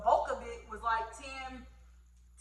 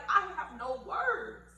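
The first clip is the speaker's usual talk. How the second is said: overwhelmed gratitude; 7 semitones higher, 4 dB louder, slower than usual. Retold or imitated. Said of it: "I'm just like".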